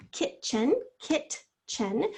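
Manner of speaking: KITCHEN broken into KIT and CHEN; said by syllables. Shown as a demonstration. Teacher goes back to syllable boundaries, KITCHEN split as kit, chen. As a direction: say KITCHEN in two separated syllables, each one distinct. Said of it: "We say the word by syllables".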